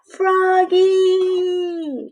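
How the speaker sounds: high call across the yard. Acted. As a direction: call him from a distance. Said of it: "lo llamó su mamá". (llamada aguda, desde lejos)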